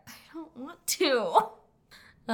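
high, long drawn-out protest; playful whining refusal. Real, not acted. Friend refusing to look at the picture, whining refusal.

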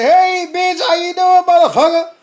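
high, loud taunting hello; mock greeting shout. What he wanted to shout back at the coworkers, loud and high. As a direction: yell it across the street.

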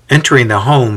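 plain reading voice

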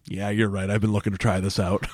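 lightly voiced smiling agreement; smiling [name]. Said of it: "[name] just smiles and goes".

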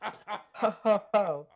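a high, soft ho ho ho in a character voice; hearty laugh. Acted. Mister Kingfisher laughing at the soaked fox, hearty ho ho ho. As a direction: chuckle like a jolly old bird. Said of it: "Laughed Mister Kingfisher".

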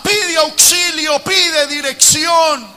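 high, loud and slowed, building to Peter's cry; preacher building to a desperate shout; shouted preaching. Acted. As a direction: cry out like a drowning man. Preaching at full force.

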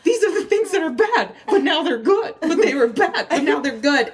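high, squeaky mock confusion; brain in meltdown. Acting out her brain getting praise, mock meltdown. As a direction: flip back and forth in a high, frazzled voice. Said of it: "my brain was just like".

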